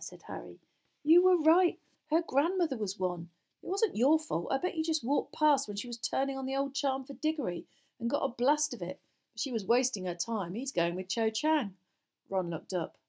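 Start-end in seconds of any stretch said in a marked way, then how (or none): 1.0-11.8 lightly voiced boy working it out; higher, animated explanation easing down; boy explaining. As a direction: explain it to a friend, lightly in character. Narrator voices a friend explaining.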